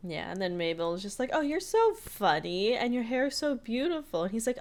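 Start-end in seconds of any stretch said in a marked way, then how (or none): 1.3-4.2 high-pitched, lingering mock swoon; gushing flirtation.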